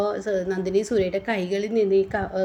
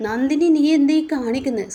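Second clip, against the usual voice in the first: raised high, loud rebuke; sharp shout; 6 semitones higher, 5 dB louder than usual. Acted. Surya shouting at Nandini to stop drinking. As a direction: snap at her loudly to stop.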